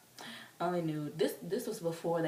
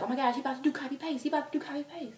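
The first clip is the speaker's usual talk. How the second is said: high, rushed fan gushing; imitated excited friend; 9 semitones higher, 3 dB louder, much faster than usual. Taryn guessing the next song, excited imitation. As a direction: blurt it out high in mock excitement.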